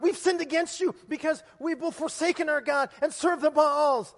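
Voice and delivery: loud, high collective confession; desperate plea shouted to God; crying out. Acted. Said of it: "They cry out".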